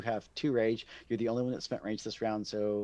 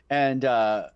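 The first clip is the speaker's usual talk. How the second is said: ordinary talk, nothing marked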